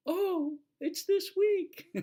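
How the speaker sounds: very high, slowed mock realisation; mock surprise. Teacher suddenly realises the deadline, high mock surprise. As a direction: draw it out high like a startled teacher.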